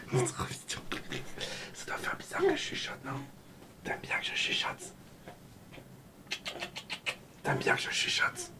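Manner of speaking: playful voiceless whisper, close to the microphone. Real, not acted. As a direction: whisper it gently to your little one.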